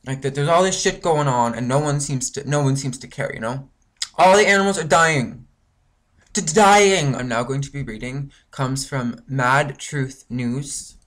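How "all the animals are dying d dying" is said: loud, higher cry, stumbling on "dying"; anguished shout. Real, not acted. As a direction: plead loudly that the animals are dying. Frustrated rant about animal deaths.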